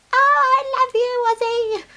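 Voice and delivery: very high, slow, cooing shriek. Retold or imitated. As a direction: coo it up high like squeezing a little puppy. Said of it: "She raised her voice".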